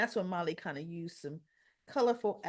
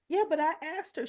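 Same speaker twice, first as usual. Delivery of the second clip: high, retold self-defence; defensive protest; 8 semitones higher than usual. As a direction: object quickly in a raised pitch. T J defends himself to Stella.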